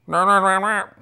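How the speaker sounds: loud, high mock intercom garble; imitation of unintelligible speaker squawk; garbled intercom mumble. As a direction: blare wordless noise like a broken drive-thru speaker. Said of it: "they mumbled something into the intercom".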